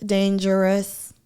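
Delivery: usual speaking voice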